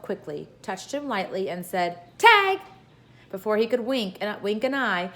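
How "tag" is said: high, loud gleeful "Tag!"; bright playground cry of Tag; playful shout. Acted. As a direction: shout it like a child winning a game. Pippi tags the policeman playfully.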